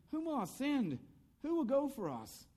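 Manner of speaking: high, ringing call in God's voice; strong voice crying out. Acted. God calling for someone to send, strong crying-out voice. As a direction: call out for a volunteer, pitched well up. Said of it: "in a strong voice saying".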